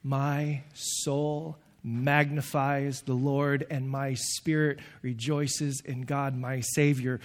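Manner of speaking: slow, soft, worshipful reading; tender reverent reading. Acted. Preacher reading Mary's song to the congregation, softly. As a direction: savour each word gently. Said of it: "Mary said".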